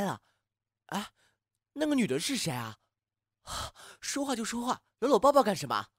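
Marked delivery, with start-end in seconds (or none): 4.0-5.9 louder, higher jealous snap; jealous outburst. Acted. Watching his crush hug a girl, jealous loud complaint. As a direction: spit it out in jealousy.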